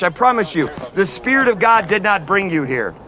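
normal talking voice

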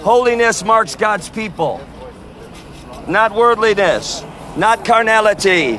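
measured, drawn-out preaching with long pauses; street sermon. Real, not acted. Street preacher warning the crowd outside the event, preaching. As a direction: call the passers-by to repent.

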